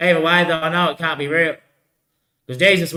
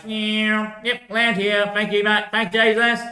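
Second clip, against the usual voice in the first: a higher, light mock voice for the aliens; lightly voiced alien travellers, higher and bright; 7 semitones higher, much slower than usual. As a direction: chirp out the aliens' landing thanks, pitched up. Voicing the landing aliens.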